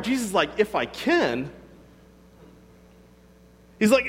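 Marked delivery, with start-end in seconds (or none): 0.6-1.5 "can" swooped up as an incredulous question; questioning echo. Retold or imitated. Jesus answering the father, incredulous on "can". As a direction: throw "can" back as a challenge, pitch leaping. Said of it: "notice the question mark".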